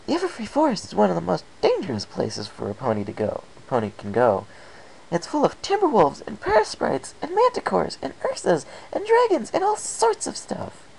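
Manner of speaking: wide-eyed excitable mare hyping up a scary forest; Pinkie's voice. Acted. Pinkie lists forest dangers.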